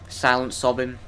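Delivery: usual speaking voice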